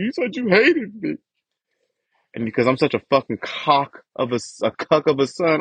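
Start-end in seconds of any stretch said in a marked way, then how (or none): 0.0-1.2 mimicking mom. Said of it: "that's the part that she'll fucking flip".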